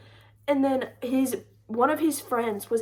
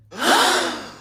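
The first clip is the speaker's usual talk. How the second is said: sharp loud intake of breath in disbelief; a big audible gasp of mock outrage; loud shocked gasp; 4 semitones higher, 6 dB louder than usual. Real, not acted. Shocked at his fair habit.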